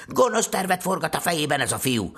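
high-pitched, forceful accusation; accusing stepmother. Acted. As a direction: denounce the boy to his father. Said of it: "vádolta Jancsit".

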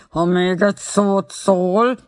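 measured menace, each word stretched out; menacing threat. A narrator's reading. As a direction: threaten slowly and very convincingly. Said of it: "Hangja igen meggyőző volt".

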